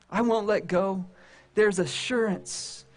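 ordinary talk

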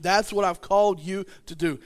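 ordinary talk, nothing marked

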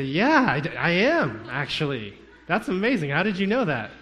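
loud, high mock astonishment; exaggerated amazement. Caught pretender answering the surfer's guess, mock amazed. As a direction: blurt it out, hammed-up and swooping. Acts amazed confession when found out.

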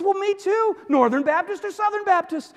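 very high, loud eagerness; thrilled match, straight into the next question; mounting excitement. Acted.

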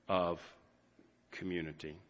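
community. said very soft and low, trailing away; hushed community. Real, not acted. Pastor softly closing a thought, hushed. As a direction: let community. fall to a near hush. Tender close of a sentence.

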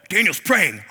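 spring the trap with delight; hammed-up caught-you exclamation; mock gotcha discovery. Officials catching Daniel praying.

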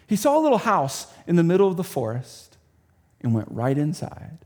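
usual speaking voice

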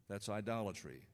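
normal talking voice

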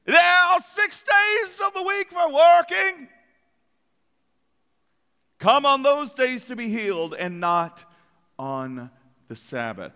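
put-on high, pompous British accent laying down the rule; a prim British synagogue leader; mock British accent. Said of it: "I'm sure he spoke in a British accent".

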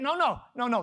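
ordinary delivery

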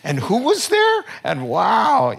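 squeaky, loud astonished cry; jaw-dropping surprise at his wife's boldness; amazed disbelief. Retold or imitated. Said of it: "and I was like".

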